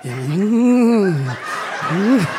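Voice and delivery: low pleased mm then breathy laugh; mock blissful moan; pleasure moan. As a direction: melt into the foot rub. Foot massage pleasure.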